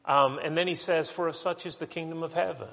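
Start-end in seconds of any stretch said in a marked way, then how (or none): 1.1-2.7 softly read, higher and quicker; gentle, hushed scripture quote. Acted. Jesus gently speaking of the children, soft and gentle. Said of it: "that's the way we say it".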